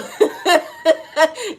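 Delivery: bursting high laugh. Real, not acted. Laughing at own Jumanji joke, high and loud.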